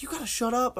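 high-pitched retold scolding; mock scolding. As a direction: imitate a mother's urgent telling-off, high. Acting out mom scolding giggling son.

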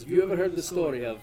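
lightly voiced husband keen to tell a tale; eager storyteller. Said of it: "The husband leans over and goes".

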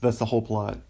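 normal talking voice

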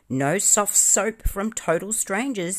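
high, slow, snubbing retort; rude rebuff. A narrator's reading. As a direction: cut them off rudely, drawing it out. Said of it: "said the pudding rudely".

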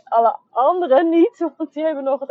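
high, loud laughing admission. Real, not acted. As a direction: laugh it out at yourself. Laughs at her own mistake.

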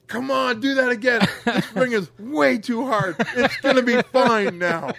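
high, loud, gleeful urging; eager wrestler voice. Retold or imitated. Wrestler imitating Mantis X asking to be slammed again, high and loud. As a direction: beg for another slam, thrilled. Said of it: "he goes".

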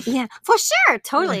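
put-on high, bright Valley Girl uptalk; Valley Girl accent. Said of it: "It's the accent we love".

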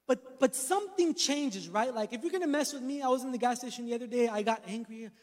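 ordinary delivery

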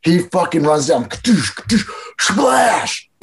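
stretched, rising sound-effect noises; cartoon kadoosh and splash noises; imitated crash and splash. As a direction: act out the tackle, going up in pitch on the splash. Acting out the tackle and splash.

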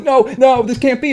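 high-pitched retold freak-out; mock panic. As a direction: act out a helpless, panicked protest. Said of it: "I'm freaking out".

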